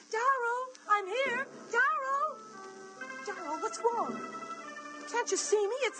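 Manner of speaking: high desperate calls across the swamp; calling out to Daryl. Acted. Woman calling to her friend across the swamp. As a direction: call his name, pleading.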